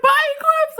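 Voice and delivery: very high, loud imitated yell; re-enacted wailing farewell shout; yelled goodbye. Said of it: "Like, I yelled it".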